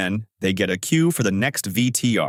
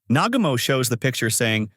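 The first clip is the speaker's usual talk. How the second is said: plain reading voice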